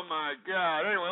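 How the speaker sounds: ordinary talk, nothing marked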